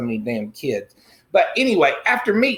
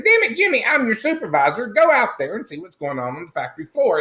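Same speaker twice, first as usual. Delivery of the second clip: bossy yell; 6 semitones higher than usual. Retold or imitated. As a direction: pull rank on Jimmy, shouting. Supervisor yells at Jimmy.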